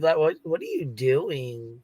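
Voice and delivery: usual speaking voice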